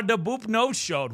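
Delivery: usual speaking voice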